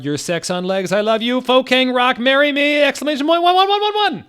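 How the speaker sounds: hammed-up fangirl shriek rising to a screech; frantic fangirl screaming. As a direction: scream it like a crazed fan. Fangirl gushing over Gerard.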